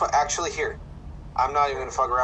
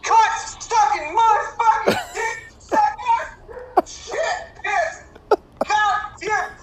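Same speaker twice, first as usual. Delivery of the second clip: much higher, shrieking furious swearing at a bad golf shot; Chris Taylor raging; 5 dB louder, much slower than usual. Impersonating Chris cursing his bunker shot, screeching fury. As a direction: explode in high-pitched cursing rage. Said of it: "Now, if I were Chris and that went in the bunker".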